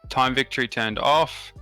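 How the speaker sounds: usual speaking voice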